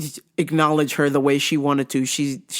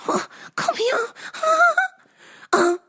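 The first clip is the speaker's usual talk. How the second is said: very high, sing-song calling; hammed-up high customer voice; mock strange customer. Customer calling the worker over, very high mock voice. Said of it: "she did the come here hand".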